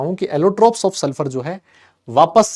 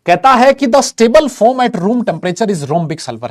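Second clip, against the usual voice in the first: slow loud textbook reading; 6 semitones higher, 6 dB louder, much slower than usual. Real, not acted. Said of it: "reading textbook line".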